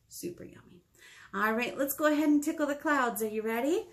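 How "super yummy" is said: soft, unvoiced whisper; a hushed little treat-whisper. Librarian telling kids seeds are tasty, whispered.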